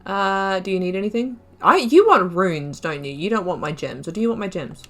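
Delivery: usual speaking voice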